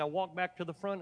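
usual speaking voice

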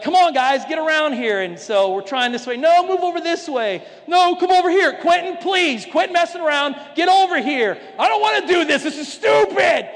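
high, loud orders to the family, getting fed up; bossy photo herding. Retold or imitated. Pastor ordering the family into the shark photo, loud. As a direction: round everyone up for the picture, exasperated.

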